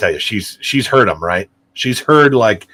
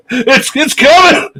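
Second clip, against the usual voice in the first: excited warning; 13 semitones higher, 5 dB louder than usual. Retold or imitated. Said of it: "fart game retold".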